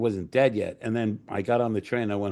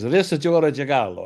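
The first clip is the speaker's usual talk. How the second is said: put-on raised-pitch Italian accent; 4 semitones higher, 4 dB louder than usual. Dino rings back five minutes later, put-on Italian accent. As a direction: imitate an Italian caller asking for someone. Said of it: "when you do the accent".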